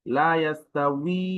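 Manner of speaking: লা ইয়াস্তাউই slow, drawing out the final wee; correct w sound. Shown as a demonstration. Teacher quotes a Quran example, careful w. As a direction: stretch লা and the last উই of ইয়াস্তাউই. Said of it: "এরকম ভাবে".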